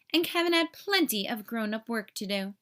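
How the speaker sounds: ordinary delivery, nothing marked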